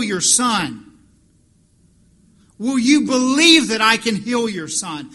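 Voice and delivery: loud, rising sermon climax; preaching at full force. Real, not acted. Pastor presses the crowd on belief, loud and forceful. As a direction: drive each question home to the congregation.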